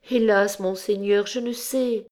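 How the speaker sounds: high, fading lament; despairing plea. A narrator's reading. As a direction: let the voice rise and fail. Tortured girl answers judge. (plainte aiguë qui s'éteint)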